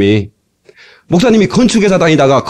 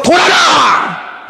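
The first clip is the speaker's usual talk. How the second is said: high-pitched, building to a long cry; stretched, ringing command; rallying shout; 11 semitones higher, much slower than usual. Acted. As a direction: call out the marching order. Preacher acting out a bold command.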